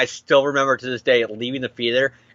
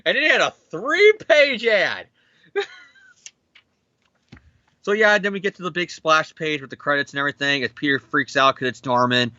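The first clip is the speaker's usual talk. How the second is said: ordinary talk, nothing marked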